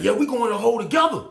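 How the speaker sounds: high, loud and fast; wild insistence. Retold or imitated. Inmate telling John they both go to the hole, fast and loud. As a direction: rush it out, hyped up. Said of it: "He like".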